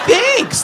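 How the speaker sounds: high giggly squeal then thanks; doughboy giggle. Acted. Doughboy looking at his own butt, a high coy giggle. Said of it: "he'd go".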